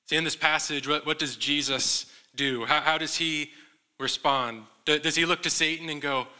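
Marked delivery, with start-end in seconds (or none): none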